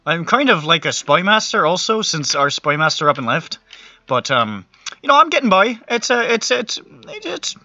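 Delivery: flustered wizard. Acted. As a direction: justify yourself haltingly to a scornful predecessor. Flustered Ossian defends himself to Infernal.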